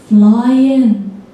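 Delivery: "Flying" in a broad northern English accent; northern English "Flying", drawn out. Shown as a demonstration. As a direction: say "Flying" as a Durham lad would. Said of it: "What does he say?".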